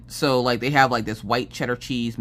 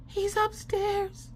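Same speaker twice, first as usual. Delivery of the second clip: put-on much higher, slow child's voice; worried little girl; 7 dB quieter, much slower than usual. Daughter asking mom about dad, high worried child voice. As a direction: ask like a worried small child.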